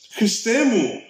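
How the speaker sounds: loud cry. A narrator's reading. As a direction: cry out to the heavens, a touch of alarm. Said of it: "φωνάζει ο Τζακ δυνατά".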